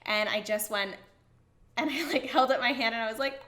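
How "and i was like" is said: ordinary delivery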